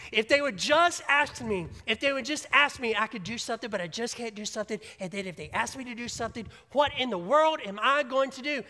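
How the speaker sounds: high, loud frantic fretting; panicked God. Acted. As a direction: play a panicking God wringing his hands. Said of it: "God's not up in heaven going".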